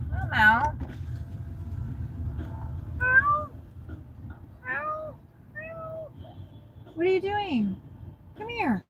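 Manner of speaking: high sweet call to a stray kitten; high-pitched kitty coaxing; high cat-calling voice. Real, not acted. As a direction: coo up high to coax the cat.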